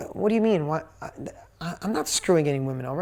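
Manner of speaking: lightly acted stammering protest; imitating someone caught off guard, halting; taken aback. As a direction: stumble into a mock-flustered denial. Acting her taken-aback, stammered denial.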